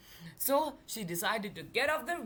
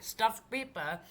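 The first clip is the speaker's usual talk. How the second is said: plain reading voice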